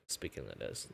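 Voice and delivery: dropped low and soft, easy; relaxed low voice. Shown as a demonstration. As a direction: let the voice sink down relaxed. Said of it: "my lower, lower end of the voice".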